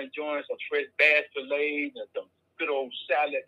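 usual speaking voice

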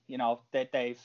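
ordinary delivery

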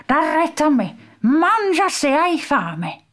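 put-on high, knowing old man's voice; toothless old man. A narrator's reading. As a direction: lecture the girl like an odd old sage.

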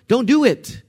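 urgent plea. Acted. Naomi voiced urging daughters back.

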